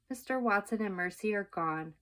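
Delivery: ordinary delivery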